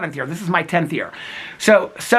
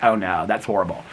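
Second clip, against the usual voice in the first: imitating Alex; 10 semitones lower, 3 dB quieter than usual. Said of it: "Alex is like".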